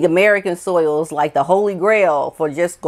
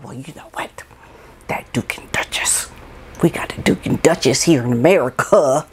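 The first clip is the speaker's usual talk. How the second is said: mock whisper; 5 semitones lower than usual. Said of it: "Are they supposed to be like".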